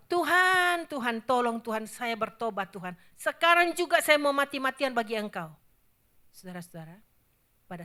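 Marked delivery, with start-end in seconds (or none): 0.1-5.5 a lightly voiced frantic plea to God, louder; desperate prayer. Preacher voicing a left-behind sinner praying, pleading. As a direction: beg God like a lost man. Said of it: "Dia akan berdoa".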